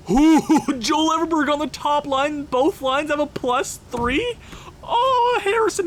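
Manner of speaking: high, breaking with happy tears; overwhelmed joyful squeal; giddy relief. Real, not acted. Overjoyed seeing new lineup.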